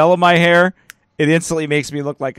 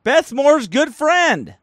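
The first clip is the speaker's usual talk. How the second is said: much higher, slow, dripping sarcasm; mocking disbelief; 11 semitones higher, slower than usual. Shown as a demonstration. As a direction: repeat it like you can't believe it. Repeats the title in mocking disbelief.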